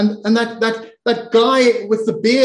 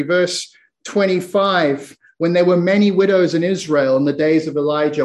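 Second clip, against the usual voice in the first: normal talking voice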